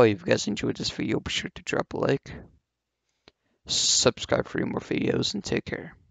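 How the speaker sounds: soft sign-off. Real, not acted. Outro sign-off.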